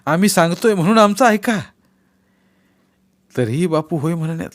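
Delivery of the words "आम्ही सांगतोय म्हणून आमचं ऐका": higher, louder insistence; old man urging Bapu loudly; urgent plea. Acted. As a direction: press him to listen.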